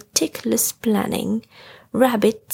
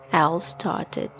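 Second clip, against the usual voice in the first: ordinary delivery, nothing marked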